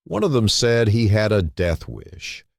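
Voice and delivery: ordinary delivery, nothing marked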